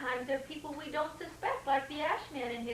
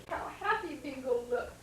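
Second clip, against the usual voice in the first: plain reading voice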